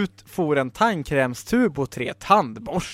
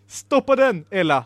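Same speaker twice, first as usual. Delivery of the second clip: raised-pitch alarmed call to a friend; shouted order; 3 semitones higher, 4 dB louder than usual. Acted. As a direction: yell to stop it at once. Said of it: "skrek Bodil Bäver".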